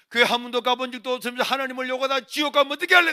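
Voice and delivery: loud, high, insistent appeal; urgent pleading. Retold or imitated. Son warning mother of hell, urgent loud pleading. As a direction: warn her with passion.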